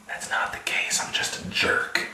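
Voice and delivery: a sly whispered aside. Real, not acted. To the viewers, a sly whispered aside. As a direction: whisper the confession under your breath.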